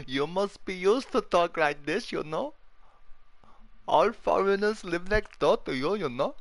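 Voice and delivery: mock voice. Mocking voice at teammate.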